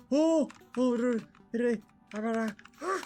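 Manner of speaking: ordinary delivery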